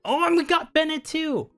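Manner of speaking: ordinary talk, nothing marked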